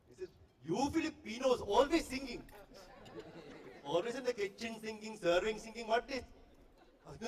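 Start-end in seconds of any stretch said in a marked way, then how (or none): 0.6-6.2 high, scolding Indian waiter; Indian accent. Retold or imitated. Indian waiter scolding a supposed Filipino kitchen hand, Indian accent. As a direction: complain in an exasperated Indian accent. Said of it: "this Indian waiter".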